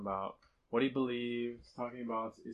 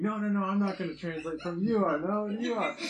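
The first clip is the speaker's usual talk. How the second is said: raised, higher voice of a translator refusing to translate; mock argument; 9 semitones higher, 6 dB louder than usual. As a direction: push the job back onto the other man, insistently. Two translators arguing over who translates.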